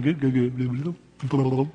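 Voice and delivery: put-on burbled cooing at a baby; baby talk. Leaning over the baby carriage to make it smile, burbled baby talk. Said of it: "baby talk".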